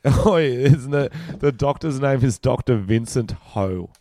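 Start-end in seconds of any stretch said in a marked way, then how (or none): none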